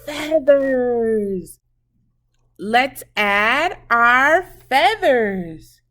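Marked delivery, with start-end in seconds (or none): none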